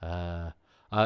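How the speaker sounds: ordinary delivery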